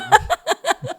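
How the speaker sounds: a sudden high squeal of laughter. Real, not acted. Singer laughing at the host's joke, high squealing laugh.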